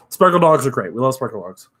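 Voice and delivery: ordinary delivery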